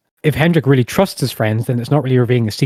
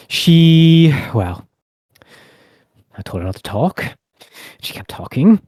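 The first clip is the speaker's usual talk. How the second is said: usual speaking voice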